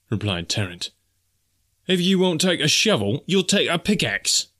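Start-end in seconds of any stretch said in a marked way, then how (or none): 1.9-4.5 menacing threat. Acted. Tarrant threatening Snipes before the blow, rising fury.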